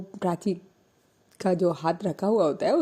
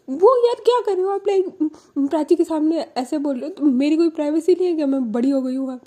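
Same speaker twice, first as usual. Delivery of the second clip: whiny protest; 7 semitones higher, 6 dB louder, faster than usual. Retold or imitated. Niece protesting to aunt.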